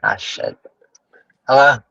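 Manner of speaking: ordinary talk, nothing marked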